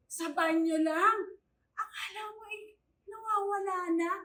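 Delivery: loud, disbelieving complaint; exasperated scoff. Acted. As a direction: scoff loudly at the clingy child. Yaya mocks clingy charge behind back. (pasinghal na reklamo)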